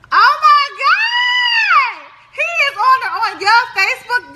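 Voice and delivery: a slow drawn-out high scream of disbelief; shocked shriek. Real, not acted. Discovering her father on her mother's Facebook, screaming in shock.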